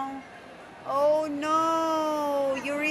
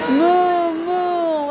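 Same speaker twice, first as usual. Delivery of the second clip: put-on loud, drawn-out cow moo for a toddler; showing a toddler how a cow sounds, playful loud moo; 4 semitones higher, 6 dB louder than usual. Shown as a demonstration. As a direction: moo like a cow to teach the baby. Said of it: "What does the cow sounds like?".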